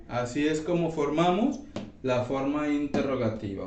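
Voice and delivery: ordinary talk, nothing marked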